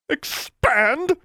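as if it's an indignity; appalled exclamation. A narrator's reading. Goody Pruitt appalled at the school expanding. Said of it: "Goody Pruitt exclaimed".

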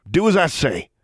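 barked order. A narrator's reading. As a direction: bark it, allowing no argument. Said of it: "he barked".

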